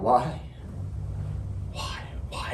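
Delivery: hushed, fading plea; pleading to God. Acted. Marine asking God amid slaughter, hushed pleading. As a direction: ask it helplessly, sinking away. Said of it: "I asked God".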